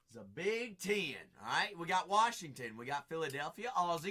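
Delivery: ordinary talk, nothing marked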